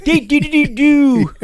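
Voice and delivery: cartoon gibberish. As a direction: squeal nonsense syllables like a cartoon kid. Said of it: "and he goes".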